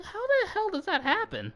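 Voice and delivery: high-pitched retold disbelief; incredulous. Teller amazed a twelve-year-old is so tall, incredulous. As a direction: squeak it out, baffled.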